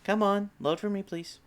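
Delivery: coaxing high, then trailing off; pleading with a machine. Real, not acted. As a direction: beg the upload to load. Pleading with the image upload.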